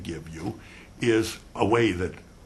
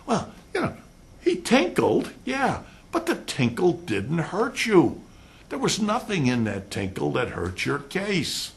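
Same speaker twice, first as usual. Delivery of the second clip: high colleague voice; 9 semitones higher than usual. Retold or imitated. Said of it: "colleagues argue the point".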